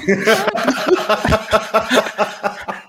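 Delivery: high, sustained laugh; real giggling fit at your own joke; laughter. Real, not acted. Laughing at his own admission.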